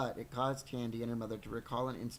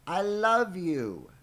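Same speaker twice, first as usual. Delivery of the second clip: big, high, drawn-out declaration of love; very loud, slow, soaring proclamation; shouted confession; 8 semitones higher, 11 dB louder, much slower than usual. A narrator's reading. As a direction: cry it out loud, swooping down at the end. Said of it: "Then he confessed".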